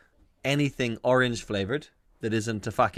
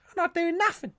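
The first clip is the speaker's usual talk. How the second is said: put-on very high English street-kid voice; little unthreatening thug. Street cat just standing there, high unthreatening thug voice. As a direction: squeak it out as a harmless little thug. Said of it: "Like a little unthreatening thug".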